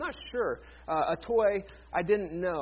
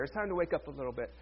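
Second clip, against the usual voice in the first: usual speaking voice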